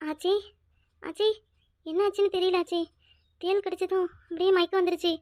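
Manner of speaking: faint, just coming round; weak, dazed. Acted. Wife tells granny about fainting, weakly. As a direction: speak softly as if barely awake.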